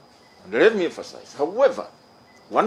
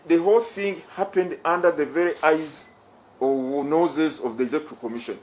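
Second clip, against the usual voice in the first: ordinary delivery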